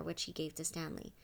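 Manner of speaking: ordinary delivery, nothing marked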